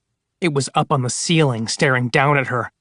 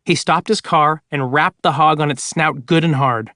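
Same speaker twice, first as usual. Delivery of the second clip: ordinary delivery, nothing marked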